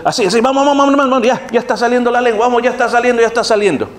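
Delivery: high-pitched, rapid urging, hammed up; mock excited coaching. Fake coach cheering on someone learning tongues, mock excited. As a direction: egg them on in a mock frenzy.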